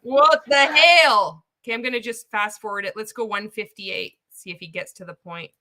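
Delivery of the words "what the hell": loud, drawn-out mock exclamation; slow, higher holler, hammed up; loud mock echo. Streamer echoing the coach's exclamation, loud and drawn out.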